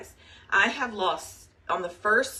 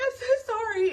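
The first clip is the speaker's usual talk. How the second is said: high, whiny imitation of herself crying; mock tearful apology; 5 dB louder than usual. Wife apologizing to husband in a high, teary mock voice.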